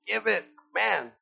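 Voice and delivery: usual speaking voice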